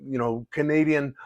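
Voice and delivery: ordinary talk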